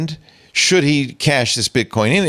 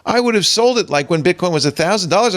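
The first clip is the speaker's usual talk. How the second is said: ordinary talk, nothing marked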